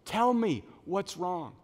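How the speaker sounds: loud, high, drawn-out "use your words"; exasperated parent. Acted. Parent coaxing a crying child to speak, acted exasperation. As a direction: stretch each word out for a small child, mock exasperation. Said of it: "Same thing".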